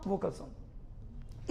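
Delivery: Vocal sound breathed without voice; whispered. Shown as a demonstration. Teacher whispering the sound name while pointing. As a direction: whisper it to the board. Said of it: "demo of sound".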